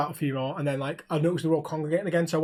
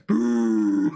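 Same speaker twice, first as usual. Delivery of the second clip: crowd boo; 8 semitones lower, 4 dB louder than usual. Retold or imitated. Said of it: "they're all like".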